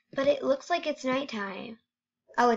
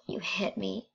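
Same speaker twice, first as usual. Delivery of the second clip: whisper; 3 semitones lower, 7 dB quieter than usual. Real, not acted. Hit by a mob in game.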